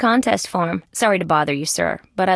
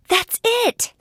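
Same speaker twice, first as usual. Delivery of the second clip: much higher burst of realization; sudden eureka. Acted. Detective spotting the clue, excited high exclamation.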